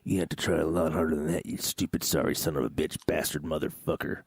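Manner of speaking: very low, muted threatening voice; low, measured comic menace; mock menacing insults. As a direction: drop deep and curse them out.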